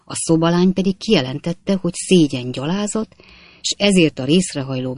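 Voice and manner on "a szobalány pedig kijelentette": ordinary delivery, nothing marked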